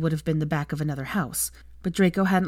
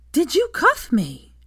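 much higher, louder and slow, furious; angry; 11 semitones higher, 6 dB louder, slower than usual. Acted. Hermione confronting Draco about the cuff, angry demand. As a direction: demand it through anger. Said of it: "she asked angrily".

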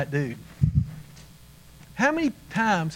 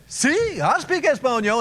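eager Spanish guy; 8 semitones higher than usual. Retold or imitated. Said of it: "So the Spanish guy will say".

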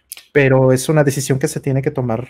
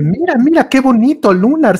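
much higher and louder, delighted; excited gushing; 10 semitones higher, 4 dB louder than usual. Retold or imitated. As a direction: gush over it like a fan. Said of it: "Artemio dice".

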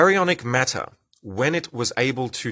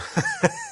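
short high-pitched chuckle; a quick burst of laughter. Real, not acted. Host amused at being hard to understand, chuckle. Amused at own Australian speech.

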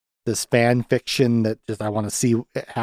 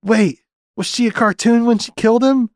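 high, loud cartoon voice, flustered; hammed-up Lonnie; 13 semitones higher, 3 dB louder, slower than usual. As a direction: squeak it out like a cartoon girl. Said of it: "And she's like".

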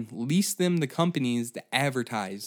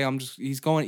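ordinary talk, nothing marked

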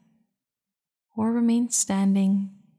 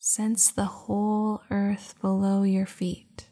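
guided meditation. Acted. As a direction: guide them into calm, unhurried. Leads a meditation.

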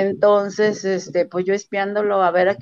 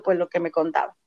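ordinary delivery

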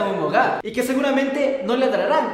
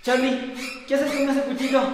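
normal talking voice

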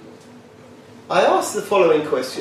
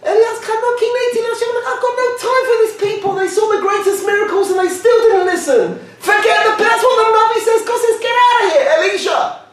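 high, shouted mock outrage; mock Eliyahu rant. Preacher voicing Eliyahu fed up with the people, high shouting.

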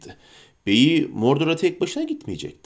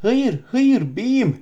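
higher, slow anguished wail; crying out; 8 semitones higher, much slower than usual. Acted. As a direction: cry out in despair, drawn out. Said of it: "diye feryat etti Gollum".

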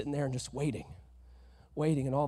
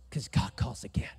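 hushed storytelling whisper; dramatic whisper; 5 semitones lower than usual. Real, not acted. Pastor building suspense in the story, whispering.

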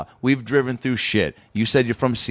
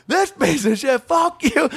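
angry shout; 13 semitones higher, slower than usual. Retold or imitated. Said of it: "He goes".

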